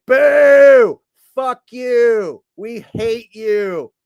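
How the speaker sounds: high, drawn-out yells of a jeering crowd; loud booing. Retold or imitated. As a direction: heckle like a whole hostile arena. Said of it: "the Hulkster got booed louder".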